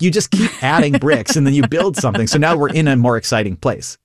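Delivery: ordinary delivery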